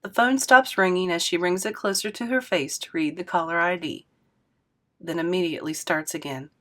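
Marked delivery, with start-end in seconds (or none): none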